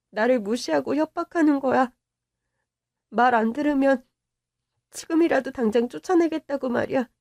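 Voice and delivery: usual speaking voice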